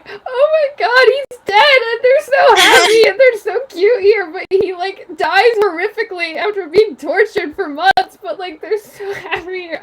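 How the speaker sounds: high, loud, hammed-up tearful wail; mock bawling. Fan recounting a character's death, mock bawling. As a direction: wail it out as if bawling, half laughing. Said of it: "I just started bawling".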